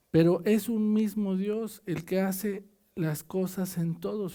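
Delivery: scripture reading. Acted. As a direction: read the verse out to the church. Reads scripture aloud to congregation. (lectura de la Biblia en voz alta)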